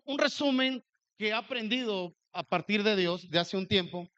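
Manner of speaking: ordinary talk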